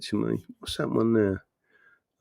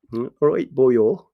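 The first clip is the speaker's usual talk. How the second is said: put-on Welsh accent; 4 dB louder, slower than usual. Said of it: "I'm gonna put my Welsh accent in".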